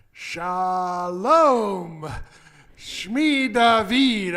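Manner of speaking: put-on slow, drawled Texas-accented Hebrew greeting. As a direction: say the Hebrew with a thick Texas twang, slowly. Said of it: "in my good Texas accented Hebrew".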